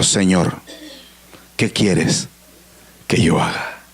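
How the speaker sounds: very low, halting prayer ending in a whisper; humble plea fading to a whisper. Acted. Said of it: "indica humildad".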